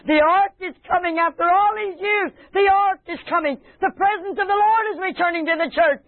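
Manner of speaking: high, jubilant preacher's cry; overjoyed proclamation. Acted. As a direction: rejoice aloud as the long-awaited arrives. Acts out David rejoicing at the ark.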